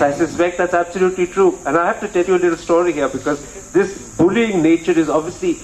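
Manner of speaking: usual speaking voice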